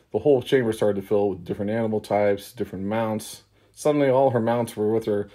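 normal talking voice

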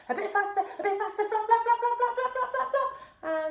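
rapid-fire high flapping. Shown as a demonstration. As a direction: speed up excitedly, pitch climbing high. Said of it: "gonna go a bit faster".

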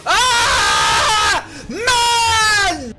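screaming in frustration; loud frustrated yell; berserk rage. Real, not acted. Pack opening, screaming.

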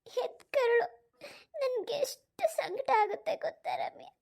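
high, slow, breaking with sobs; sobbing mother. Acted. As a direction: let the tears break the words apart. Mother sobbing over the phone.

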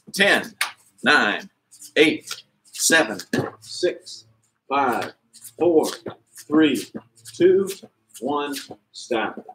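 muffled under a box; counting with a box on your head; box over head. Real, not acted. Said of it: "with a box on my head".

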